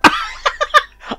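sudden high laugh, almost squeaked; burst of laughter. Real, not acted. Laughing at the narrator echoing her words. Reacting to the story's line.

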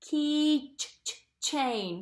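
Key ch, ch, chain with ch stressed; isolating ch in keychain; sounding out. Shown as a demonstration. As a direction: repeat the ch in chain. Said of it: "sh sound or a ch sound?".

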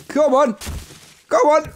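high coaxing come on; calling chickens. Real, not acted. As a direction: urge it on, squeaky high.

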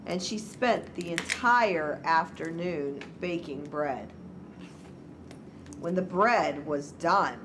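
measured picture-book narration, key words stretched; story read to children. A narrator's reading. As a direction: read a storybook aloud to little ones.

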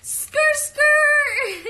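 high squealed catchphrase; loud, high-pitched playful yell; playful shout. Pretend. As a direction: shriek it out like screeching tires, in play.

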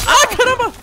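high, loud shriek of surprise; startled yell. Real, not acted. Player surprised by an enemy appearing, startled yell. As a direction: yelp as if ambushed.

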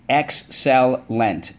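"excellent" stretched slowly as ex-cel-lent; syllable by syllable. Shown as a demonstration. Teacher reviewing words with the class, slowly syllable by syllable. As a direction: tap "excellent" out slowly, each syllable separate. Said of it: "Let's go".

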